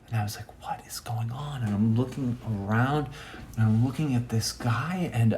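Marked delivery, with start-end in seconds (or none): 0.6-1.6 hushed, whispered aside; whispered disbelief. Retold or imitated. Storyteller watching odd subway passenger, baffled whisper. Private reaction to strange passenger.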